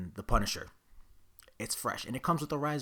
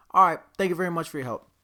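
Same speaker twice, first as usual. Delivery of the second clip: rushed thanks; 8 semitones higher, 11 dB louder, faster than usual. Retold or imitated. Hurried thanks to store clerk.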